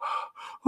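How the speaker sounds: small, airless startled gasp; timid gasp. Pretend. A fearful dog seeing waggled food, gasping. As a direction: catch your breath like a nervous dog.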